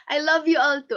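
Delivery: a laugh catching in the throat, close to tears; moved and a little teary as she answers; touched. Real, not acted. Teacher answering her students' love, touched and teary.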